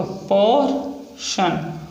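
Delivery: slowed teaching pronunciation; slow drawn-out word. Shown as a demonstration. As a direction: stretch each syllable slowly while writing. Repeats word while writing.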